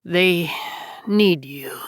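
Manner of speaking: slightly weakened, halting voice of a dying man; lightly voiced last words, broken by pauses. A narrator's reading. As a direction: strain out a few last words.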